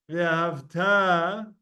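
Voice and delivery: "Ve'ahavta" slow, stressed on the final TA; "Ve'ahavta" as ve-a-hav-TAA; stress on tav. Shown as a demonstration. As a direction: hold the last syllable of "Ve'ahavta". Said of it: "pronunciation on the tav at the end".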